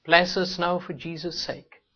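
ordinary talk, nothing marked

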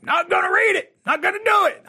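high-pitched mock refusal in a put-on accent; stubborn men's put-on accent. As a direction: caricature a man digging his heels in. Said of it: "some kind of accent to their".

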